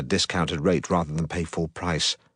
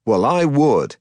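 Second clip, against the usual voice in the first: smug mid-Atlantic taunt, higher and louder; mid-Atlantic accent; 6 semitones higher, 4 dB louder, slower than usual. Acted. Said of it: "his ridiculous mid-Atlantic accent".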